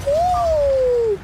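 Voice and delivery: high, loud cheering woo. Real, not acted. Gamer whooping after surviving hit, loud high woo.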